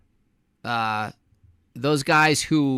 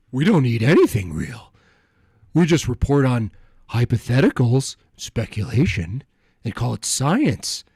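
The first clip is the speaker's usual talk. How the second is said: loud sneering scientist voice; mocking scientist voice; 5 dB louder than usual. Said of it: "let me translate".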